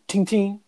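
high double chime noise; ping it out high, like a small bell; high-pitched mimicked tings; imitated ting. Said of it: "All you hear is".

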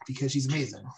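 ordinary talk, nothing marked

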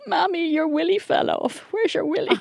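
high, mock-wailing little boy; crying toddler. Presenter reading a listener's story, toddler bursting into tears. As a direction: play a tearful three-year-old, hammed up. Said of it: "he bursts into tears and goes".

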